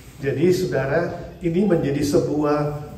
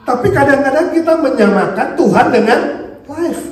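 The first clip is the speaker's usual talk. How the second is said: ordinary delivery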